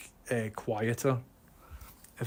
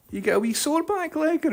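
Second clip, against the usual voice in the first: high tender dog talk; 13 semitones higher than usual. Real, not acted. Asking dog about sore leg.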